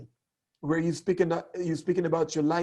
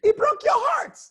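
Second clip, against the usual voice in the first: pitched way up and louder; emotional line, high and loud; 6 dB louder than usual. Shown as a demonstration. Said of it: "You can say, for instance".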